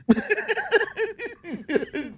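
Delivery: real laugh jumping up high; high burst of laughter. Real, not acted. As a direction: let out a high, quiet giggle. Laughing at the Tuckums nickname.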